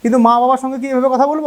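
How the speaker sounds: usual speaking voice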